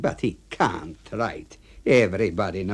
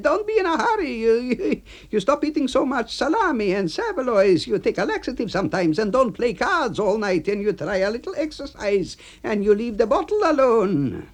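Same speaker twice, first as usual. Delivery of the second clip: much higher voice, shrugging it off; dismissive A B; 14 semitones higher than usual. Acted. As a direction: brush off the complaint. AB lecturing.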